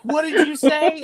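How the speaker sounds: a mother who can't believe her ears; wife shocked by her son's swearing; shocked mom. Retold or imitated.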